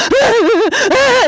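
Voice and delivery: high, hammed-up wailing sobs; imitated bawling. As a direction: wail as if crying uncontrollably. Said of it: "you know, like this".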